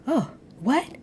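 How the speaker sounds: high, mock-startled gasps; Mary's reactions. Mary listening in on the argument, mock-shocked gasps. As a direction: play the nosy onlooker reacting. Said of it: "Mary is going".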